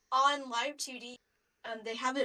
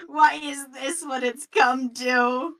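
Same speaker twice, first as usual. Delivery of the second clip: mock despairing cry; 7 dB louder, slower than usual.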